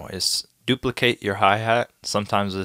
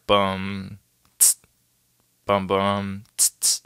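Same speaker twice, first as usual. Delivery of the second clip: vocal drum accents; slower than usual. Shown as a demonstration. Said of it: "do accents on the top".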